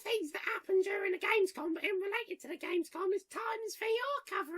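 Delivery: high, hammy Cockney news voice; Cockney accent. Acted. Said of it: "entirely in terrible Cockney accents".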